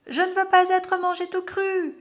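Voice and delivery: cri perçant très aigu et plus fort; s'égosiller. A narrator's reading. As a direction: crier à s'en casser la voix. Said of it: "s'égosille Anicet". (Anicet qui s'égosille de peur)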